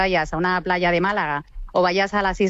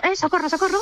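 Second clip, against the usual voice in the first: pánico fingido; 7 semitones higher than usual. Acted. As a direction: imitate panicked plankton raising the alarm, lightly. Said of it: "es una reacción de pánico". (pánico fingido, socorro en voz aguda)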